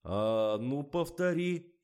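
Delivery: slow, high, loud command; demanding order. A narrator's reading. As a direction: order a repeat, stretching the words. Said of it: "велел".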